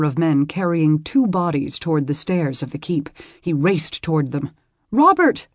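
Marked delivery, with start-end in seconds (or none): 4.9-5.5 high, loud frightened cry; frightened call. Acted. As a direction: call after him in fear. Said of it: "Bethany called after him".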